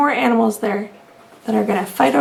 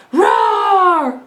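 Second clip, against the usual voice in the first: put-on lion jumping out to scare the old lady; playful lion roar; 5 semitones higher than usual. A narrator's reading. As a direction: leap out with a storybook roar. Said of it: "the lion jumped up".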